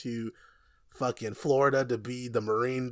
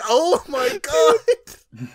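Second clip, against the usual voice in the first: awed gasp; 14 semitones higher, 9 dB louder, much slower than usual. Retold or imitated. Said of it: "I was like".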